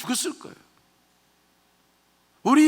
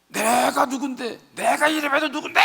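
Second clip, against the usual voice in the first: indignant yelling; 5 semitones higher, 5 dB louder than usual. Acted. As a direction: act out a proud general bellowing in outrage. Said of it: "막 소리 지르는 거죠". (거만하게 소리 지르는 목소리)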